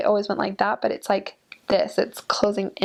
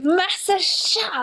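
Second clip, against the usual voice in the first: high, drawn-out call; imitated local exclamation; 9 semitones higher, much slower than usual. Showing how locals point out a far place, high drawn-out call. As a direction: stretch it out high and slow. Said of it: "this is like".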